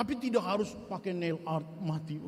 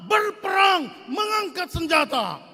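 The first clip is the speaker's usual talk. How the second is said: loud, high preacher's cry; rising, full-force call; preaching shout; 9 semitones higher, 10 dB louder than usual. Real, not acted. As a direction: shout it out to stir the congregation. Preacher climaxing the story.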